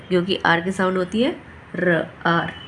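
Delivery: usual speaking voice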